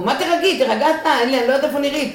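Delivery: high, loud, frantic snap back at her brother; hysterical retort. Retold or imitated. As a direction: throw his calm back at him in hysteria. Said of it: "אני הייתי בהיסטריה". (צועקת בהיסטריה על האח שאומר לה להירגע)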